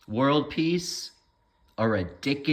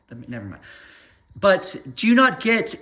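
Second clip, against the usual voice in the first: ordinary talk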